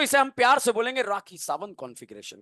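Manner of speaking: ordinary talk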